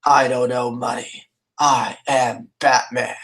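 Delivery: a hint of Batman growl; lightly voiced Batman reply; retold Batman voice. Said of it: "And I'm like".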